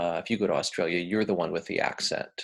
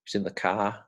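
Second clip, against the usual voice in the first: R-less Aussie 'kah' for car; demonstrated Australian accent. Shown as a demonstration. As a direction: imitate Australian, dropping the R. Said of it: "they don't roll their R's".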